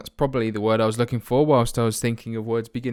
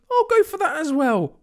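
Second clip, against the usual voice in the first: high, hammed-up dopey keeper deciding; mock goalkeeper voice. Vlogger voices his goalkeeper's silly decision, hammed-up and high. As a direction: play the keeper as a clueless show-off. Mocking goalkeeper's thoughts aloud.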